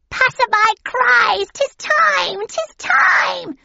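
very high, loud, slow witch screech. A narrator's reading. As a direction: screech it like a cackling hag. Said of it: "screeched the third".

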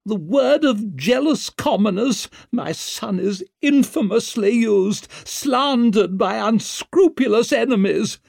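high, forceful outraged mother; imperious high-pitched tirade; Lady Wetterland, indignant fury. Acted. Lady Wetterland defends her son.

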